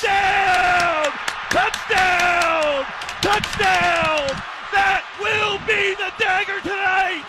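high, ringing repeated shouts; triumphant touchdown call. Real, not acted. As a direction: announce the clinching score in full voice.

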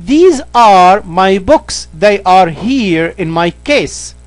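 slow, careful reading of the English example. Shown as a demonstration. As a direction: read each word slowly for the learner. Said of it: "الجمع قريب بنستخدم". (قراءة بطيئة وواضحة للمثال)

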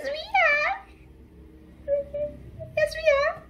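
high-pitched excited squeal; fangirl squealing. Real, not acted. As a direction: squeal it out with joy. Fangirl reacting to teaser.

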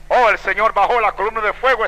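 ordinary talk, nothing marked

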